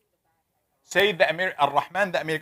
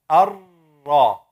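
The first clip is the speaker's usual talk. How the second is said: ordinary talk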